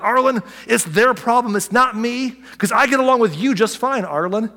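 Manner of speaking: mock a petulant churchgoer blaming others; imitating a self-righteous friend; complaining congregant. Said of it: "They're like".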